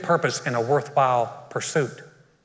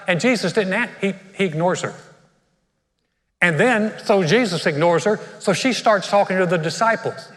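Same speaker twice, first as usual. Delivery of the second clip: usual speaking voice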